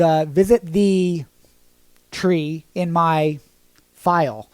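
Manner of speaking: slow, coy way round a banned phrase; cagey slow hint. Real, not acted. Dodging the algorithm while pointing viewers to his profile, slow and coy. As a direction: drag the words out slowly, hinting. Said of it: "can't say it 'cause the algorithm will spank me".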